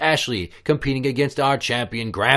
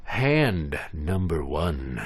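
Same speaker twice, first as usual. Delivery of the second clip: slow dramatic announcement; drawn-out game call; slow announcer; 5 semitones lower, much slower than usual. Acted.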